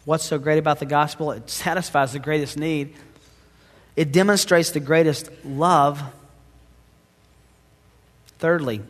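ordinary delivery

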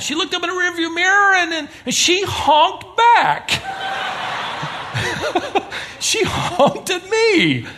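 high, slow, laughing through the words; laughing disbelief. Real, not acted. Preacher telling the congregation about the driver, laughing. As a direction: laugh in disbelief as you tell it. Laughing retelling honk story.

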